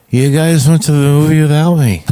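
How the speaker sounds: mock-wounded whine, high and loud; hammed-up hurt drunk; put-on act. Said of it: "I just started the act".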